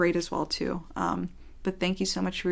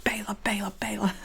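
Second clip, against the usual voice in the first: obsessive repeating; 2 semitones higher than usual. Pretend. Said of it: "go home and be like".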